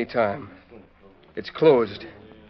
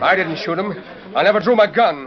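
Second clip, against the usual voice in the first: high and loud denial; loud protest; 7 semitones higher, 4 dB louder than usual. Acted. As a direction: insist you're innocent. Gambler protests innocence.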